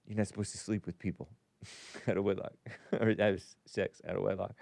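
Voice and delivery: lightly voiced mother, quiet and quick with chuckles; retold motherly warning. Host recalling his mother's talk about sex, retold quietly. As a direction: softly imitate a mother's warning, breaking into small laughs.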